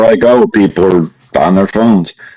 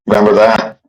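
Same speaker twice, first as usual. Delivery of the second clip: normal talking voice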